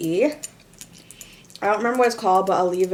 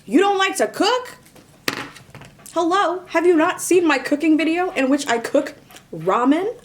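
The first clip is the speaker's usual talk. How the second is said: much higher, louder disbelief; incredulous; 8 semitones higher, 3 dB louder than usual. Real, not acted. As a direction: act offended at the question. Incredulous rebuttal.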